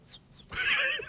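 whistled bird chirp; bird call. Shown as a demonstration. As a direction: chirp like a songbird. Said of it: "我就发现我可以学鸟叫". (学鸟叫)

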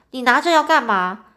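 loud angry scolding. A narrator's reading. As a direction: demand it sharply, scolding. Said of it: "便走上前去大声骂他说".

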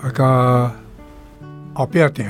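usual speaking voice

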